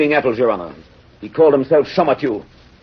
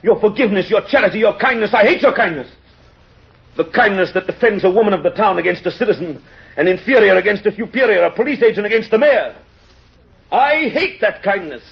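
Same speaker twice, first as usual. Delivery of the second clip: pitched high and insistent, refusing forgiveness; indignant outburst; 7 semitones higher than usual. Acted. Javert to the mayor, refusing his forgiveness, indignant and high. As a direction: rage at being forgiven, voice climbing. Inspector demands the mayor punish him.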